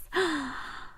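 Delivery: sharp, high gasp of delight. Real, not acted. Crafter admiring a handmade birthday gift, delighted gasp. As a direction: gasp at a lovely surprise.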